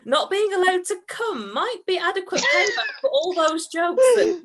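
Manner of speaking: high, loud, breaking into laughter; laughing shout. Real, not acted. Reader cracking up at his own line, laughing. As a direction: fight through giggles at the filth. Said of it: "reader breaks down laughing mid-line".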